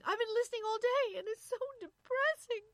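high, loud tearful wail; sobbing. A narrator's reading. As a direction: cry it out in distress. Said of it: "Irene was sobbing".

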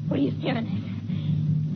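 slow breathless whisper; eerie whisper. Acted. Wife, changing, faces her husband.

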